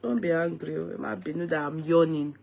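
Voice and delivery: ordinary talk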